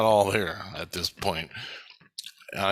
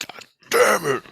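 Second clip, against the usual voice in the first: mock angry curse, higher and louder; frustrated curse; 9 semitones higher, 6 dB louder than usual. Legion guy hearing the seeds were stolen: frustrated mock curse. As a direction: spit it out, fed up with the loss. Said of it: "And he's like".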